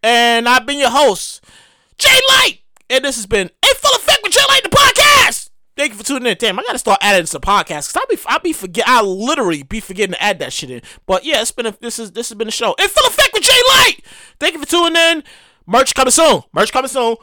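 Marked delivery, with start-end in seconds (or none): 2.0-2.6 high, punched name announcement; announcer sign-off. Acted. Host announces his own name. 3.5-5.5 high, hyped show-title announcement; announcer shout. Acted. 12.8-14.0 high-pitched, hyped title call; announcer sign-off. Acted. Host announces show title.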